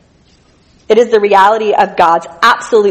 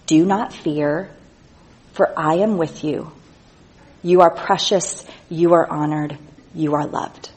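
softer reassuring reading to the congregation; tender scripture reading; 3 semitones lower, 6 dB quieter than usual. Acted. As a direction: read it as a gentle promise. Reads God's comforting words gently.

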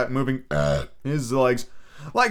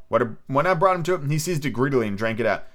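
ordinary delivery, nothing marked

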